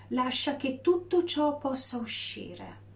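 normal talking voice

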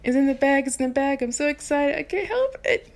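high giddy squealing; excited. Retold or imitated. Teen waiting on the radio call, giddy and high. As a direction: bubble over like a teen fan sure she won. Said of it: "So I was excited".

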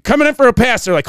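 mock set-call; crew calling out. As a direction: crew shouting orders on set.

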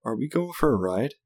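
put-on kid voice, rising at the end; small child asking. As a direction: ask like a hopeful child after a tantrum. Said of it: "still huffing and puffing".

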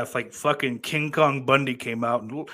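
usual speaking voice

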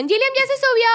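put-on very high-pitched kid call; mocking sisters' voice. Sisters telling mum to drive off, high kiddie voice. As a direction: imitate bratty sisters calling out. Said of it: "las carepichas de mis hermanas dijeron".

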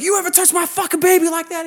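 high, loud mock threat; imitated enraged dad. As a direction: act out a father exploding over his daughter.

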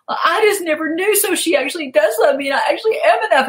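high, fast girlish voice imitating her client. Coach mimics her client's realization, high and fast. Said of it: "She goes".

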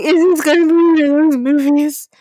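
loud, overwrought retold wail; mock tearful. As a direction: play up being emotionally overwhelmed. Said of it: "I fucking cried at the trailer".

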